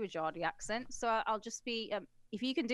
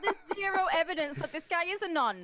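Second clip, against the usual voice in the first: high, louder, through laughter; incredulous and giggly, pitched up; laughing disbelief; 7 semitones higher, 4 dB louder, faster than usual. Real, not acted. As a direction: crack up while making the point. Cracking up at the absurd story.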